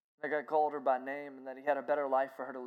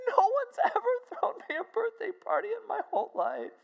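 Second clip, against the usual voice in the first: high female voice, overcome with tears; imitated weepy disbelief; 4 dB louder than usual. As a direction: play her on the edge of weeping. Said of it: "she started to weep".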